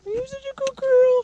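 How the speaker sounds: high squeaky praise for a dog. Real, not acted. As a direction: coo at the dog in a tiny high voice.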